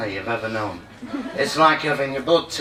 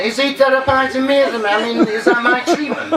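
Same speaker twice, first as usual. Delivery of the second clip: high, fast, laughing incredulous aside; amused disbelief; 9 semitones higher than usual. Retold or imitated. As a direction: wonder aloud through a laugh. Comic retells her inner wondering.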